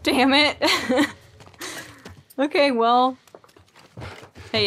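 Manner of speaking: normal talking voice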